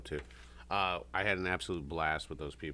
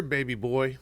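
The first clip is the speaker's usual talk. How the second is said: put-on baby boy said higher and louder, smug; mock taunt; 6 semitones higher, 5 dB louder than usual. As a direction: sneer baby boy like a cocky old man. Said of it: "He goes".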